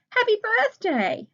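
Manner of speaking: high, loud excited shout; called out. Acted. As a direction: surprise party cheer, high and loud. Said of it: "Anne's friends called out".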